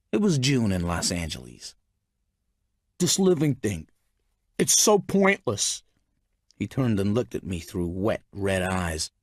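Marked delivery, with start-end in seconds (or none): 3.0-5.8 despairing man. A narrator's reading. Narrator voices despairing Mystery.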